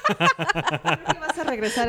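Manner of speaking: slow laughing tease; giggling while teasing. Real, not acted.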